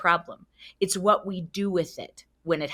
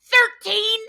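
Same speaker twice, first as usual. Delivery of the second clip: high, loud, furious yell at the ref; angry coach screaming at a young ref; 10 dB louder, slower than usual. Retold or imitated. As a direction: bellow it at the referee in outrage. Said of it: "I yell at him".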